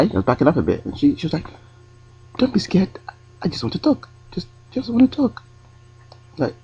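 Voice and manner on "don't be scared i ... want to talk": high, soft coaxing voice in a put-on European accent. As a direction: coax him gently in a mock Eastern European accent. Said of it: "try and do an accent".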